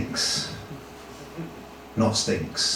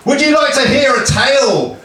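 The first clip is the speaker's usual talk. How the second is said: higher, projected heroic storyteller voice; grand storytelling; 4 semitones higher than usual. Acted. Achilles offering the crowd a tale, grand storytelling. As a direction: announce an epic tale to a crowd.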